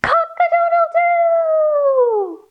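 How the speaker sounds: high, drawn-out cock-a-doodle-doo; rooster crowing. Acted. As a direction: crow like a rooster, long final note. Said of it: "we see a rooster crowing".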